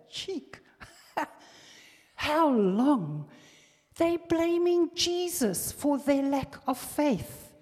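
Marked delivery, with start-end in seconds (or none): 2.1-7.5 mock indignant crowd. Mocking the doubters' complaint.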